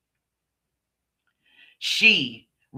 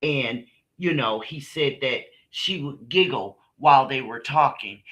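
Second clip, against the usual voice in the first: ordinary talk, nothing marked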